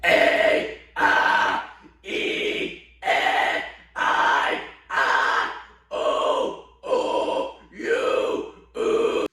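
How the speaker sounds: screamed vowels, no voice; false chord vowels. Shown as a demonstration. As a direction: growl each vowel in turn. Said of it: "it makes it higher, lower, or a mid".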